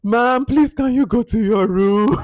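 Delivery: high, loud, pleading mock staff voice; imitating hotel staff. Staff begging a guest to return to her room, high mock plea. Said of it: "The staff must be like".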